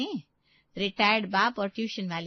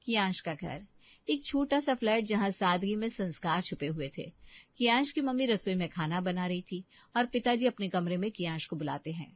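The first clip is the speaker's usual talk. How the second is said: low, soft storyteller voice; story narration; 5 semitones lower, 4 dB quieter than usual. A narrator's reading. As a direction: narrate the scene change gently. Narrator sets the next scene.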